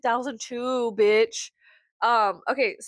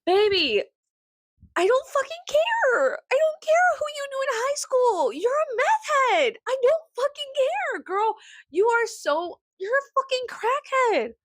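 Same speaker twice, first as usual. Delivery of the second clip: exasperated rant; 13 semitones higher than usual. Retold or imitated. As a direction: go off at her, voice up high. Ranting at the high school girl.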